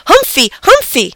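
put-on high, excited little-boy shout; toddler shouting. A narrator's reading. Toddler rushes to the hamster, shouting high. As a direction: call out like a tiny child.

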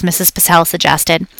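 ordinary delivery

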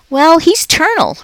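put-on high, bright kid answer; a keen schoolgirl blurting it out; eager child voice. A narrator's reading. Heidi blurting to the teacher, high eager child. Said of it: "replied Raise Your Hand Heidi Hopper".

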